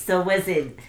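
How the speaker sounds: normal talking voice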